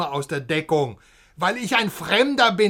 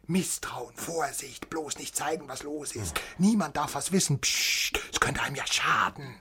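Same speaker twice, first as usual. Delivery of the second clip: much softer, half-whispered mimicry of caution; mock secretive hush; 5 semitones lower, 7 dB quieter than usual. West German mocks his friend's secrecy in a mock whisper. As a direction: play the paranoid hush of the GDR.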